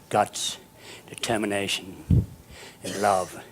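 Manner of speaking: soft, low, measured address with pauses; sincere gentle advice. Real, not acted. Speaker to the kids, closing moral, gentle and sincere. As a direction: bring it right down, low and tender.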